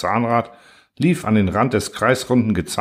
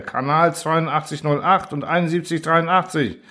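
high, urgent channel numbers; urgent order; 7 semitones higher than usual. A narrator's reading. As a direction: rattle off numbers in alarm. Engineer shouting channel numbers.

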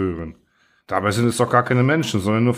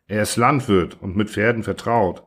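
plain reading voice

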